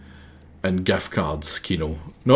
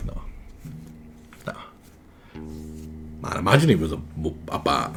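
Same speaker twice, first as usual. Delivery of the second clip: ordinary delivery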